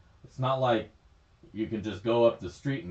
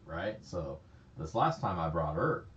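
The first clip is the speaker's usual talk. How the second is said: ordinary talk, nothing marked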